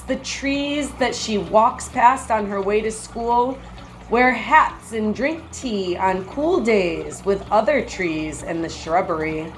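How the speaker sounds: ordinary delivery, nothing marked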